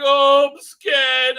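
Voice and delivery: very slow, high, loud hammed-up fear; mock scared. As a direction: drag out a hammy frightened wail. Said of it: "Dan's like".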